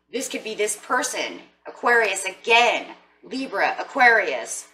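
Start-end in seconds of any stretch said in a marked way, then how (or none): none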